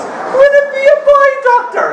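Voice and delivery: very high squeaky woman's voice; mother's falsetto. Retold or imitated. Mother asking the doctor in a high squeaky falsetto.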